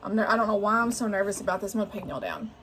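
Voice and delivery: usual speaking voice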